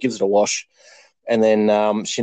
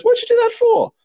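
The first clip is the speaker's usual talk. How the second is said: high, louder mock outcry; joke-teller's raised, incredulous midwife voice; taken aback; 5 dB louder than usual. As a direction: act out a midwife's startled protest. Midwife reacts to mother's whack.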